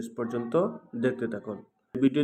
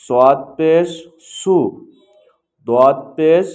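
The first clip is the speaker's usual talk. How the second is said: loud, slow, measured syllables with pauses between; letter-by-letter recitation drill; 3 semitones higher, 9 dB louder, much slower than usual. Shown as a demonstration. Teacher showing learners how to pronounce pesh, in a loud recitation drill. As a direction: call out each letter and its vowel slowly and loudly.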